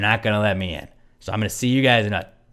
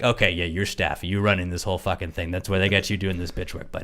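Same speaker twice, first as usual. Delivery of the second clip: low, soft sarcastic put-down; mock him under your voice, lower and quieter; dismissive comeback; 5 semitones lower, 5 dB quieter than usual. Imagined mocking comeback to staff.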